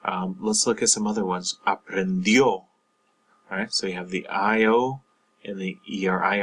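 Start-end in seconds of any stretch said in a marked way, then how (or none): 1.7-2.5 Aprendió stressed on the final ó; Aprendió with the weight on the last syllable. Shown as a demonstration. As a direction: punch the ending of Aprendió, a-pren-DYOH. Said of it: "you stress the O".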